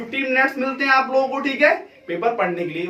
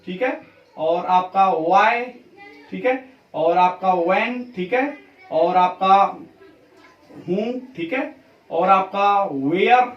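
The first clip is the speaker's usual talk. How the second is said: slow listing; 2 semitones lower, much slower than usual. Shown as a demonstration. Teacher listing question words, slow. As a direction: question words drawn out one by one. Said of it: "question generally किससे start होते हैं".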